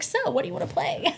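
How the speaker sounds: high, soft, quick and breezy; light imitated nonchalance; mock-casual question. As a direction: toss it off innocently, as if nothing happened. Acts friend's breezy question after kidnapping.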